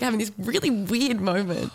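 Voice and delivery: normal talking voice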